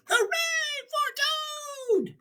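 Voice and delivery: small cheer. Acted. Little Frog in the audience cheers, tiny and high. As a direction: squeak a cheer from far back in the seats. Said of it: "Frog looked smaller as he shouted".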